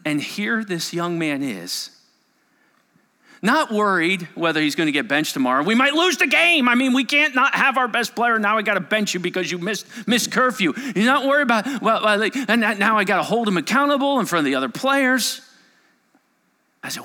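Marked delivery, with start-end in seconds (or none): 3.4-15.4 voicing coach's worries.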